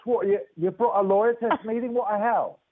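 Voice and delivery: high, outraged disbelief; incredulous outburst. Retold or imitated. Exec shocked that a lawyer came to the meeting, high incredulous outburst. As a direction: pitch it up high, can't believe it.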